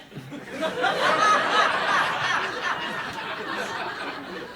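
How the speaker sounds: long high-pitched wheezing cackle; high squeaky cackle. Retold or imitated. Storyteller imitates the lady's laugh, high and squealing. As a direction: let out a tiny lady's shrieking cackle.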